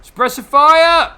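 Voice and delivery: very loud, high, drawn-out battle shout; battle cry. Real, not acted. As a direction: bellow the order like a soldier. Opening fire in game.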